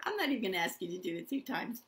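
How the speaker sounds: ordinary delivery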